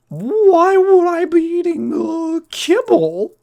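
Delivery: very high, slow falsetto boy impression; drawn-out squeaky mock indignation; Sherman. Sherman answering the bullying girl, mock high boy voice. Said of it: "And she, and he's like".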